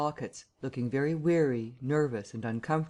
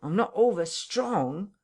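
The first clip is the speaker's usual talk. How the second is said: higher, stretched plea leaning on over; slower, more emphatic whine; 6 semitones higher, 3 dB louder, slower than usual. Acted. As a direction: repeat it more pitifully. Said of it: "he repeated".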